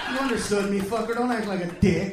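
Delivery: mock-angry, loud telling-off; loud retort. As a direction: call out the waiter's pretence. Acted retort to waiter.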